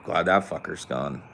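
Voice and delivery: ordinary talk, nothing marked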